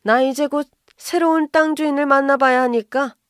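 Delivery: irritated brush-off. Acted. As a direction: cut them off, you have someone important coming. Mother-in-law brushing off daughter-in-law.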